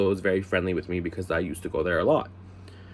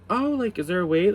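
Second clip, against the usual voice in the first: mock polite concern; 8 semitones higher than usual. Retells own polite reaction to manager.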